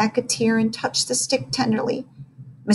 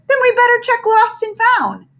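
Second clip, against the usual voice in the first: high teacher voice; 6 dB louder than usual. Acted.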